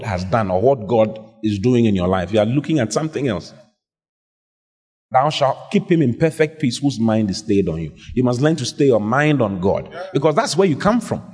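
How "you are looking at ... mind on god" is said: normal talking voice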